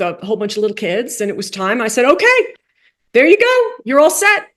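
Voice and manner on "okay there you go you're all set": high, bright encouraging okay; cheerful send-off. Retold or imitated. Coach sending the rabbi to her service, high and cheerful.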